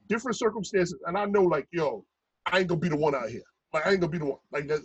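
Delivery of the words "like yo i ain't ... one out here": usual speaking voice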